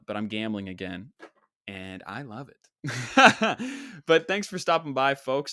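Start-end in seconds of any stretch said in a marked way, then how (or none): 2.8-4.0 burst of laughter. Real, not acted. Laughs at own joke.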